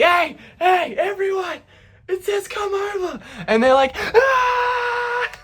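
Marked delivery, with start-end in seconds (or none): 0.0-3.2 high-pitched, thrilled call to friends; giddy acted news-breaking; excited announcement. Announcing the message to the bar. 4.0-5.3 long high-pitched scream of a cheer; imitated roar of delight, shrieked high; crowd cheer.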